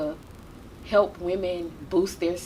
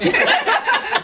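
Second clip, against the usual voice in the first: a short loud high laugh; 9 dB louder than usual. Real, not acted. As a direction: burst out laughing at the punchline. Laughs after retold reply.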